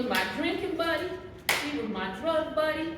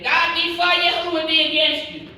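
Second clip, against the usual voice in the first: much louder declaration; preached quote; 5 semitones higher, 11 dB louder than usual. Retold or imitated. Repeating what people used to say, loud preached quote. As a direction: proclaim it like a preacher. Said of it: "hear people say".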